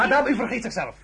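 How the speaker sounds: sharp loud reprimand; stern rebuke. Acted. Emperor sternly rebukes the defiant wife, loud. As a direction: put her in her place.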